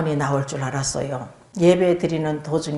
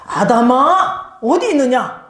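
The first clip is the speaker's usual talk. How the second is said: "아담아" slow, higher and louder, then a loud high question; God calling out; 6 semitones higher, 5 dB louder than usual. Acted. God calling to hiding Adam, slow, loud and high. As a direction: call out slowly and loudly like a voice searching the garden.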